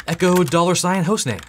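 normal talking voice

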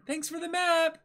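put-on a light girlish thank-you; girl's line. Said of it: "that's her".

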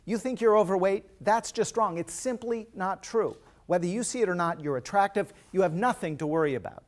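forceful, insistent mock telling-off; strident scolding. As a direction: imitate a husband emphatically correcting his wife. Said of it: "was scolding his wife".

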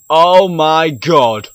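loud, slow, drawn-out panic; real fright as she appears; scared yell. Real, not acted. Ghost rising through the ground.

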